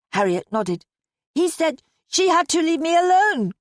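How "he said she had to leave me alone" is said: put-on high, insistent child's voice; little girl blurting. A narrator's reading. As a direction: pipe up loudly as a small girl. Child Harriet blurts out story.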